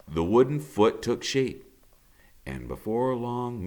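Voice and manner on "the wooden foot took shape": ordinary delivery, nothing marked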